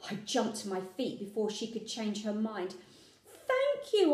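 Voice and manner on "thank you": high, excited gasp of gratitude; gasped thanks. A narrator's reading. A girl thanking her mother in delight, gasping. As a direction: burst out with thanks. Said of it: "I gasped".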